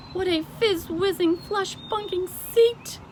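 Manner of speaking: high, loud, slow giant delight; BFG delighted cry. A narrator's reading. The giant admires his piano seat, delighted and loud. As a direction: savour the made-up words like a thrilled giant. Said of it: "cried the B F G".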